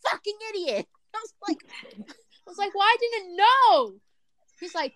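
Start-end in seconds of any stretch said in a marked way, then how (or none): 0.1-0.9 high-pitched exasperation; mock-indignant high voice; retold exasperated insult. Retold argument with partner. 2.7-3.9 high, drawn-out protest; whiny retold self-defense; retold defensive protest.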